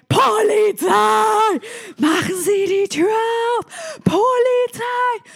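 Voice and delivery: lautes, hohes, gedehntes Brüllen; schreiender Polizist. Acted. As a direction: mit voller Kraft durch die Tür brüllen. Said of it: "und schreit". (gebrüllter Polizeibefehl)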